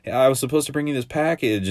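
a hint of a shifty guard covering something up; acting weird. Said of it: "he's acting real weird".

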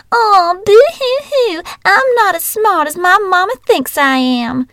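shrill, whiny mock sobbing; mocking whiny crying. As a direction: sneer with fake boo-hoo crying. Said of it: "in a shrill, whiny voice".

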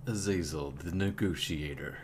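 mock trailer announcer. Player jokingly bills Azazel as a coming film, mock trailer voice.

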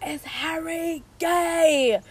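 high, slow, drawn-out mock question; Margot. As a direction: imitate her asking, stretching each word. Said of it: "where Margot goes".

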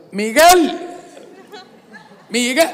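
loud, high call across a crowd; calling a friend from far off. Retold or imitated. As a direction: shout his name until he turns.

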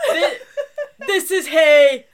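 laughing imitation. Repeating overheard line, laughing.

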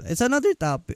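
words break into a squeak; voice crack. Real, not acted. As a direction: voice cracks up high mid-phrase. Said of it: "voice crack".

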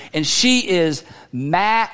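mad stretched slow into three syllables; slow and drawn-out, she is mad. Shown as a demonstration. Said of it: "three syllable mad".

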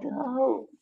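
ordinary delivery

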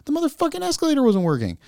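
high, exasperated rant; swearing tirade. Real, not acted. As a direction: complain with rising outrage. Ranting about broken escalator.

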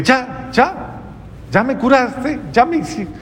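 high astonished exclaim; amazed relief. Retold or imitated. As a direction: marvel that the toothache is gone. Patient amazed the pain left.

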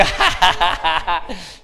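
drawn-out laughing burst; hearty laugh. Real, not acted. Preacher amused by the congregation's amens, hearty laugh.

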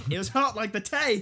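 high, laughing English accent gone Australian; botched English accent. Shown as a demonstration. Retrying English that sounds Australian, laughing. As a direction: push the fake English through laughter. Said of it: "I still sound Australian".

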